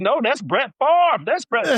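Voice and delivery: high-pitched, excited retold protest; starstruck protest. Rookie refusing coaches to watch Brett Favre, high starstruck protest. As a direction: protest in awe, voice way up.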